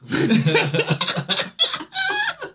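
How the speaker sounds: big high-pitched laugh; real laughter after the impression. Real, not acted. Laughing at own Andalusian impression.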